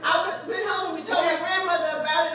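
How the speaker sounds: ordinary delivery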